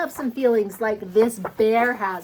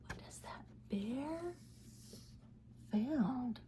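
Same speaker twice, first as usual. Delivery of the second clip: whisper; 19 dB quieter, slower than usual. Shown as a demonstration. Storytime suspense whisper.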